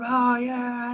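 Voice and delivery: put-on high, eager child chorus voice; kids answering. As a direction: chime in like excited second graders. Second graders answering the principal.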